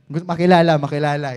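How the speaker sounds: loud, stressed makilala; loud rising fervor; preaching push. Real, not acted. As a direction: call it out to the church. Preacher urging congregation.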